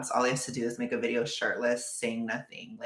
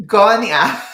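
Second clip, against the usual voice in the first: high, loud, bursting into laughter; laughing shout; 10 semitones higher, 13 dB louder than usual. Real, not acted. Streamer urging a viewer onto dating apps, shouting through laughter. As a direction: shout it until laughter takes over.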